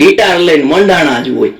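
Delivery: ordinary talk, nothing marked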